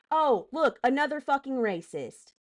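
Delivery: real irritation, pointedly sarcastic; angry sarcasm. Real, not acted. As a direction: announce it with open contempt. Calling out a hostile commenter.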